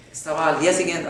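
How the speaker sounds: normal talking voice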